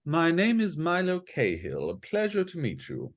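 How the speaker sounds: put-on lightly posh young man; strangely posh. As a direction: charm her with a refined voice. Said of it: "his voice is, uh, strangely posh".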